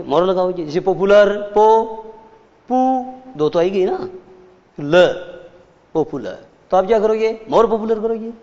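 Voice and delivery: ordinary talk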